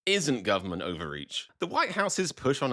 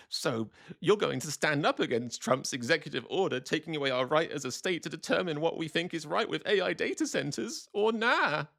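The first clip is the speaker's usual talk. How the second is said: higher, put-on voice climbing at the end; questioning poster; 5 semitones higher than usual. Voter asking the A G, high mock questioning. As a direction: ask it like an earnest online busybody. Reads a post in voice.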